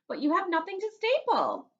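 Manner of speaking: put-on high, loud girl's retort; sassy little girl voice; Daisy teasing. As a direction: tease him like a sassy classmate. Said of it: "said Daisy".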